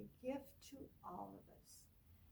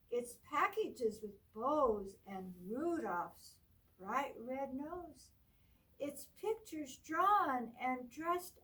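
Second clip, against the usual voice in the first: bright, lively storybook read, higher and louder; reading to children; 5 semitones higher, 8 dB louder, slower than usual. Acted. Teacher reading a Christmas book to children, lively storybook voice. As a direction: read it out like a picture book for a class.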